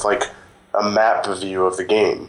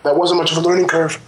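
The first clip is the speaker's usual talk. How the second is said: voice breaking; 7 semitones higher than usual. Real, not acted. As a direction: voice rises and cracks as if tearful. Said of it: "Sounded like I started crying there".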